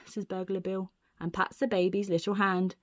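ordinary delivery

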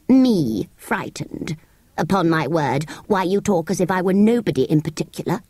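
indignant sand fairy. Acted. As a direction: scoff at being pitied.

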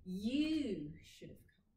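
You should have come with each word given full stress; don't reduce anything, stress all four words; You should have come said word by word, unreduced; every word stressed. Shown as a demonstration. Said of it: "c'est pas pour autant qu'on va dire".

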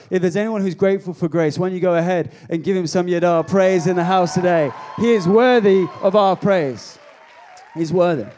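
loud, rousing call to praise; preaching at full force. Real, not acted. Preacher calling the congregation to praise, loud and rousing. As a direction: lift the room, preacher's shout.

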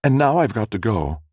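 high female customer explaining; young woman's voice. A narrator's reading. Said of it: "the young woman said".

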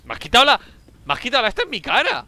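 indignant outcry. Real, not acted. Teammate took his medkit.

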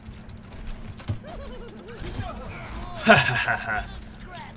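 gloating laugh. Real, not acted. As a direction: laugh at a beaten rival. Laughs at beaten opponent.

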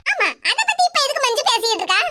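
ordinary talk

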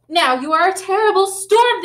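high, loud dramatic cry. A narrator's reading. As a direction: call it out like a storm warning. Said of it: "the teacher cried".

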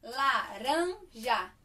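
laranja drawn out slowly in three parts; syllable-clapping. Shown as a demonstration. Teacher clapping syllables for children, slow and spaced. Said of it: "Três pedacinhos".

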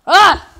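sharp loud "Ah!" of frustration; frustrated yell. Real, not acted. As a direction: yell at the screen.

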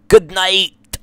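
loud, high goodbye; cheerful sign-off. Real, not acted. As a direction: sing out goodnight.

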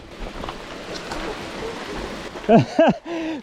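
bursting into a high giggle; high delighted laugh. Real, not acted. Delighted burst after crossing. (neşeli tiz kahkaha)

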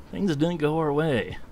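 ordinary delivery

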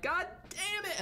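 very high, mock-anguished outburst; exaggerated retold frustration; upset exclamation. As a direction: replay your dismay in a high voice. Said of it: "I'm, like, even more sad".

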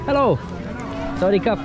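ordinary talk

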